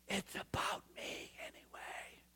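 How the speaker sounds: soft, unvoiced whisper for God's words; a hushed whisper voicing God; whispered God quote. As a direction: let God's line drop to a hush. God's voice in the story.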